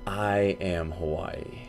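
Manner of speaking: slow, quiet, weighty line; dramatic reveal. Retold or imitated. Revealing his identity to friends, slow hushed reveal. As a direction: deliver it like a movie reveal. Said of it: "and I said".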